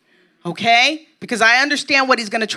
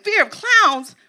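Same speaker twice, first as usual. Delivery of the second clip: mock disbelief; 6 semitones higher than usual. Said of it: "Okay, I was like".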